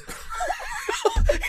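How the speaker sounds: high shrieking laughter. Real, not acted. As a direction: burst into a squealing laugh. Burst of laughter.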